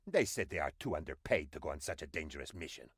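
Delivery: usual speaking voice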